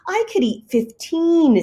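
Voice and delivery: high boastful cat voice; Plank's voice. Acted. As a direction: brag it up high.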